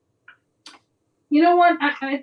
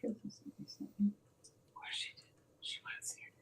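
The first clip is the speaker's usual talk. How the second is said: hushed unvoiced whisper; 2 semitones lower, 20 dB quieter than usual. Real, not acted. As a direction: whisper it under your breath. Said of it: "Why are we whispering?".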